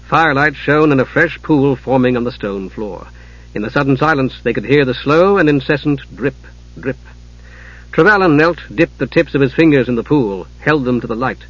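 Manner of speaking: usual speaking voice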